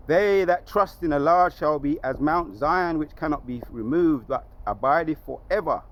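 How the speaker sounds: scripture recitation. Acted. Preacher reciting a psalm on trusting the Lord, declamatory.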